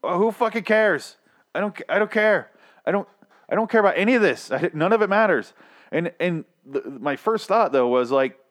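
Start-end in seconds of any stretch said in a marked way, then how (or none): 0.0-5.5 high, fed-up mock rant; exasperated rant. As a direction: throw your hands up, higher. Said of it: "And I'm like".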